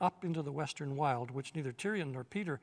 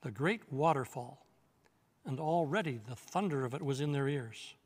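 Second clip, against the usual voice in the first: ordinary delivery, nothing marked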